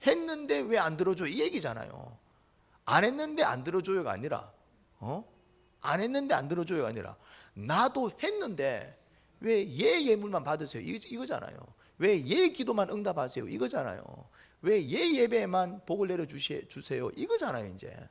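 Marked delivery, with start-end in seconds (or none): 0.1-1.3 indignant complaint. Retold or imitated. Believer complaining to God. 7.5-10.8 slow, loud and high aggrieved plea; aggrieved protest. Retold or imitated. Cain complains to God, slow, loud and aggrieved. 12.0-13.5 aggrieved protest. Retold or imitated. Cain complaining to God. 14.6-17.1 aggrieved protest. Retold or imitated. Cain complaining to God.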